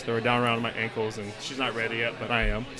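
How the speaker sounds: normal talking voice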